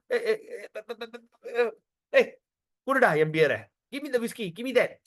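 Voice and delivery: stammering, grunting "eh" then a demand; mock drunk, halting then insistent; drunkard demanding drink. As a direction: stumble tipsily into asking for whisky. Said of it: "Can you all visualize that".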